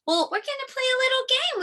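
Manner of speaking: high, fast, thrilled announcement; excited. Real, not acted. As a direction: burst out with excitement.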